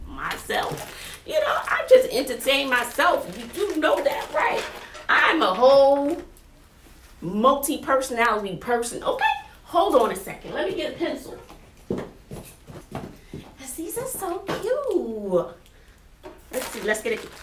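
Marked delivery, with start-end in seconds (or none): 0.1-1.2 a soft whisper after a laugh; whispered punchline. Real, not acted. Teacher whispering the joke that she talks to herself. 13.8-15.5 high, slow coo. Real, not acted. Teacher cooing at a cute gift for her classroom.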